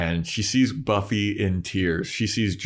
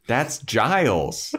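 higher, slow, over-explaining; clueless Cordelia; 5 semitones higher, much slower than usual. Retold or imitated. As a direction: point out the obvious slowly. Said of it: "Cordelia's looking around like".

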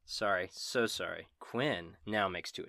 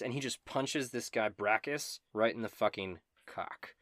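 usual speaking voice

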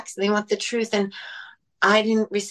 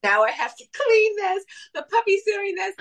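much higher, hammed-up frazzled whine; overwhelmed whine; 12 semitones higher than usual. Mother mimics her five p.m. meltdown to her kids, mock whiny and much higher.

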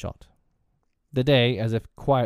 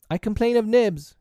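high, loud tattling cry; eager cry; 13 semitones higher, 6 dB louder than usual. A narrator's reading. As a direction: cry it out promptly. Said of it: "he cried promptly".

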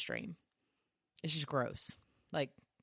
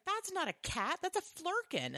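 much higher alarmed voice; panicked alien. Retold or imitated. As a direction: warn them the cat is a monster. Skrull panics at the cat.

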